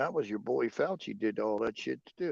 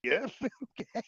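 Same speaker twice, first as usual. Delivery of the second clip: laughing; 8 semitones higher, 3 dB quieter than usual. Retold or imitated. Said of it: "I'm like".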